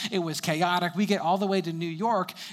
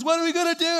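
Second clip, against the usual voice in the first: high, loud, cracking panic; frantic voice crack; 13 semitones higher, 5 dB louder, faster than usual. Retold or imitated. Panicking brother out of money at the toll, voice cracking high. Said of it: "He goes".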